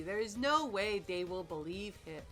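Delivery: usual speaking voice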